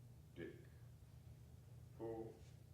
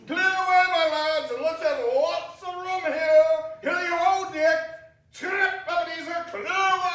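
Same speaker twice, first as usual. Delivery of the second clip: high, loud bustling commands; jolly shout; 12 semitones higher, 8 dB louder than usual. Acted. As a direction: hurry them along with glee. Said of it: "cried old Fezziwig".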